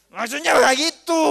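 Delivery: loud, high, drawn-out protest; exasperated mock wife. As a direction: stretch it out, fed up at being misunderstood. Wife exasperated at husband missing hint. (istri kesal, protes dengan nada tinggi)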